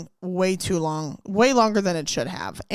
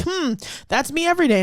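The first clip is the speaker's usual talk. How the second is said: smug manager; 8 semitones higher, faster than usual. Retold or imitated. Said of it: "managers try to act like".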